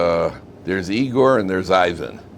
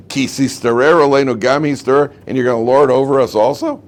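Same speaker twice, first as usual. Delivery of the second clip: loud, raised-pitch indignant challenge; indignant complaint; 5 semitones higher, 5 dB louder than usual. Acted. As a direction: throw the accusation out loudly, mock-outraged. Acting out the rebels' indignant complaint.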